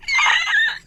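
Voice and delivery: squealing helpless laugh; high laughing fit. Real, not acted. Host cracking up at the foot story, high helpless laugh. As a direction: lose it in high giggles.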